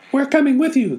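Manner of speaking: high squeaky animal cheer. Acted. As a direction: cheer like excited little animals. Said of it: "the animals cheered".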